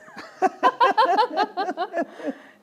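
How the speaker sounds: long, high, loud belly laugh. Real, not acted. Actor admits forgetting the trailer dialogue, long loud laugh.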